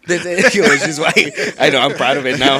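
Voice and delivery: loud, laughing admission. Real, not acted. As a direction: confess it through laughter. Laughing confession.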